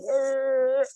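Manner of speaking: high raw grief wail; short mock howl of grief; imitated primal cry. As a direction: cry out like grief breaking loose. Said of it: "one of those primal cries".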